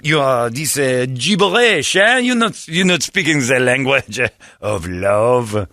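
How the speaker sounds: lightly mocking French accent, slowed; put-on French accent, unhurried; fake French. Telling the homeless guy off in a light fake French accent. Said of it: "play pretend French".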